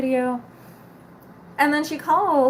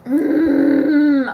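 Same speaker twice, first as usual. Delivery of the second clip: loud, drawn-out frustrated groan; annoyed groan; 3 semitones higher, 7 dB louder than usual. Real, not acted. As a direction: groan out the irritation at full voice. I'm so annoyed right now.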